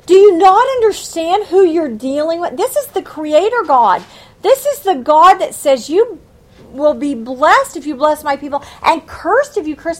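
loud, pointed rebuke; confronting the leaders. Pretend. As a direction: scold the nation's leaders, hammering each question. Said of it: "read them this and say".

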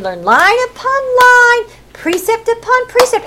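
high, slowed singsong drill; teaching little children. Shown as a demonstration. Teacher drilling children in the Torah, high singsong. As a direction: chant it for a class of small children. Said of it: "when they were teaching little children".

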